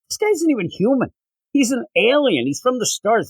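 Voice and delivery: high, scornful put-on god voice; mocking Apollo. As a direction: sneer at the alien from way up high. Apollo mocking Superman as alien.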